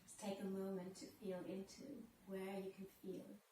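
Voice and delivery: soft, unhurried yoga teacher voice, with long pauses; guided breathing meditation. Real, not acted.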